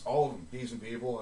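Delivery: ordinary talk, nothing marked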